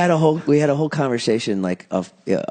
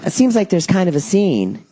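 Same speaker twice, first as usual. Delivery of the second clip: imitating know-it-all girl; 6 semitones higher than usual. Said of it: "And she just goes".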